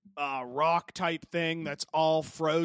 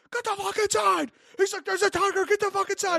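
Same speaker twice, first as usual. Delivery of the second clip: much higher, louder frantic shouting; mock yell; 7 dB louder than usual. Neighbor yelling at a man to get inside, frantic shout. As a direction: yell a warning in a panic.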